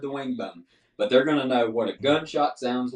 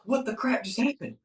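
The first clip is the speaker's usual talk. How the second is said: stunned disbelief; 10 semitones higher than usual. Retold or imitated. Buddy stunned after turkey rolls.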